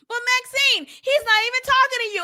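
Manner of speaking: high, quick mock protest; imitated objector. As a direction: whine it high like a protesting viewer. Voices an objecting viewer, then retorts.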